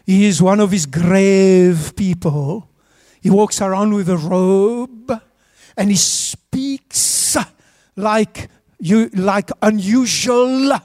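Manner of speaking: slow, drawn-out mock minister voice; pompous minister. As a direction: intone it gravely, stretching words like a pompous minister. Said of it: "That's how ministers speak".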